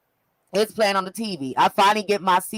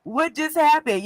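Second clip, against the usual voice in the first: retold bafflement; 7 semitones higher than usual. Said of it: "I was like".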